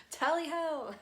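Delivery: high, loud hammed-up British cheer; mock British accent. Relaying a spirit guide's British sayings, in a loud hammed-up British accent. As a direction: shout it like a cartoon English gent. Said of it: "British accents".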